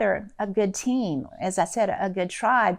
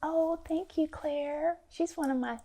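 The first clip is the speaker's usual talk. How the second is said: touched; 6 semitones higher, 6 dB quieter than usual. Real, not acted. Touched by a friend's compliment.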